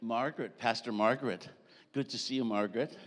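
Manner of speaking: normal talking voice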